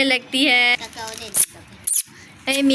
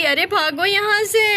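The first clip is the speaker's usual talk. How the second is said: panicked cry; 7 semitones higher than usual. Acted. Fleeing the angry cow.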